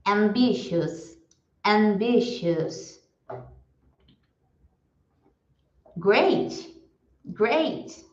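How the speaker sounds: each word said slowly and clearly, then repeated; vocabulary drill for students to repeat; repeat-after-me drill. Shown as a demonstration. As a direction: model every word for the class to echo. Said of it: "Eu vou falar e vocês repetem em casa".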